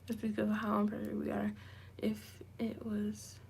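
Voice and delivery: whispering; whispered reading. Real, not acted. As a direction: whisper it to yourself. Reading comment aloud.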